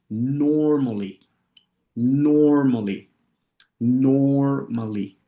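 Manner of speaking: very drawn-out "normally", falling at the end; slow model pronunciation. Shown as a demonstration. Teacher models "normally" for students to practise, slowly. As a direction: stretch the first syllable for learners. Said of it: "lo voy a decir lento".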